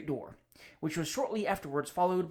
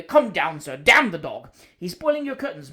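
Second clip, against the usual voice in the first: shouting at the dog; 10 dB louder than usual. Acted. Guest shouts at his dog.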